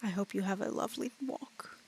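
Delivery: soft, hushed dragon voice. Acted. Dragon bids Salem farewell before the walk, softly. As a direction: fade to a breathy, courteous murmur. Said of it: "the dragon sort of just looks at you and goes".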